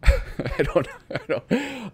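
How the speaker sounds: words broken by giggles; trying to talk through laughter; sputtering real laugh. Real, not acted. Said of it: "I feel a little bad for laughing".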